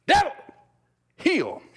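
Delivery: short, forceful command with a long pause between words; commanding address. Shown as a demonstration. Preacher showing how to address the devil, sharp command. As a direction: order it like a dog to heel. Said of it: "what you can do to address the devil".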